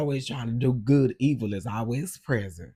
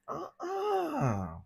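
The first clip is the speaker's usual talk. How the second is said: high uh-uh; 5 dB quieter than usual. Retold or imitated. Replying to the lady's DM.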